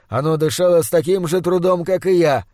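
plain reading voice

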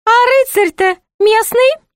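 very high, sing-song jester voice; put-on high jester voice; high-voiced jester. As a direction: play the court jester in a high, piping pitch.

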